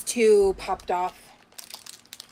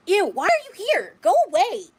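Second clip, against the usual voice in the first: sharp, high, louder "go away"; disgusted dismissal; 8 semitones higher, 4 dB louder than usual. Real, not acted. Telling an unwanted viewer to leave, disgusted and sharp. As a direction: shoo them out, fed up. Blocked person shows up in chat.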